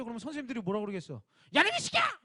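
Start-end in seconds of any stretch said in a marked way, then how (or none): 1.5-2.2 야, 이 새끼야 yelled much higher and louder; hammed-up furious teacher shouting; mock angry yell. Pastor mocks a teacher scolding a kid, yelling. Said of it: "막 이러겠어?".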